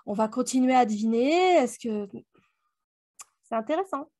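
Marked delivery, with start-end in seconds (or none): none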